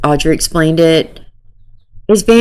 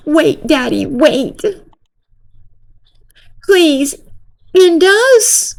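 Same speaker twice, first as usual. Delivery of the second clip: higher, hammed-up pleading girl voice; mock girly plea; 11 semitones higher, slower than usual. Girl begging Nick in chat, mock high plea. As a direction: squeak it out like an overdramatic teen.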